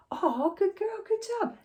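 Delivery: put-on sky-high sing-song good girl; high praise voice. Shown as a demonstration. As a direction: squeal it like praising a puppy. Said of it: "praise demo to dog".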